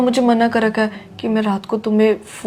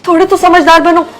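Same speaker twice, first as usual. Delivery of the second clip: higher and louder, fed up; louder, exasperated girlfriend; exasperated scolding; 5 semitones higher, 5 dB louder than usual. Acted. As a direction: tell him to grow up. Scolding childish boyfriend.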